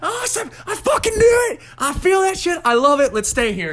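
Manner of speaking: very high, hammed-up excited squeal; retold excitement. Telling his girlfriend he feels it too, wildly excited. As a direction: squeal with glee, starting sky-high and easing down. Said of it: "and I got excited".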